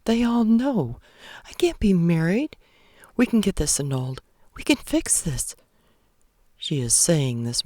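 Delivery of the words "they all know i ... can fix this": higher, rushed, frantic pleading; lightly voiced panicked wife. Anna Mae pacing the room, frantic and higher. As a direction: talk herself out of it fast.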